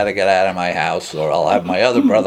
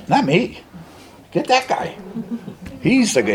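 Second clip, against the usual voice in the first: higher-pitched, animated deflection; protesting; 11 semitones higher than usual. Retold or imitated. Man deflecting an offer of help onto his brother, protesting. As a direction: pass the offer to someone else, pitched up. Retold plea to send someone else.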